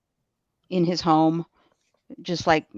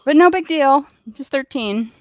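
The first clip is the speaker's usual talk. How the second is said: bitter sarcasm, pitched way up; sarcastic; 8 semitones higher, 6 dB louder than usual. Real, not acted. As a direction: toss it off with biting irony. Bitter remark about a thirteen-year-old victim.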